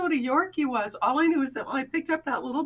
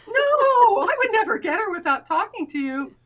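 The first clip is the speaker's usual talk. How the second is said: mock-innocent denial; 8 semitones higher, 6 dB louder than usual. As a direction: protest far too much, pitched way up.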